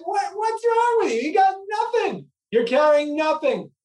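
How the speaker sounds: high incredulous voice; exasperated scolding. Acted. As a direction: scold the lazy dog in disbelief. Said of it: "turns to the dog and says".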